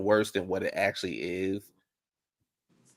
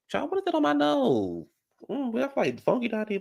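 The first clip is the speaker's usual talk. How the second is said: surprised squeal; 12 semitones higher, 5 dB quieter than usual. Real, not acted. Reacts to a smell.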